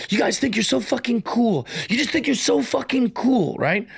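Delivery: mock a sulky punk kid losing it; grumpy kid crashing out. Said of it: "while he's crashing out".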